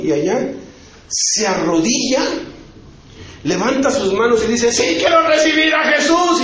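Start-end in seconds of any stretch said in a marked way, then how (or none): 4.7-6.4 joyful declaration. Retold or imitated. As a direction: proclaim it as you kneel, arms raised. Convert kneels and declares aloud.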